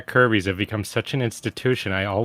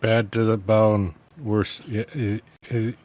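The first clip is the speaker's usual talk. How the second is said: bad voice; slower than usual. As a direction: sound like a tough guy about to pass out. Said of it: "That's my bad voice".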